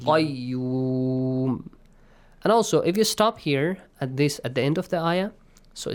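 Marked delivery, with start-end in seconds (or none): 0.0-1.7 "Qayyum" with a thick back-of-throat q, end held long; heavy Qaf. Shown as a demonstration. Teacher demonstrates the heavy letter, drawn-out Qayyum. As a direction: recite "Qayyum" heavily, stretching the last vowel. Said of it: "has to be pronounced heavily".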